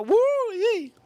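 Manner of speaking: high-pitched "woo" whoop; gleeful high squeal; delighted whoop. Real, not acted. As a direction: whoop high with relish at the memory. Savouring memory of the wine.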